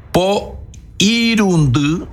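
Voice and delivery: Po irundú said slow and high; drawn-out number. Shown as a demonstration. Teacher stretching the long form slowly, Po irundú. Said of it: "No digo todo, digo porundú".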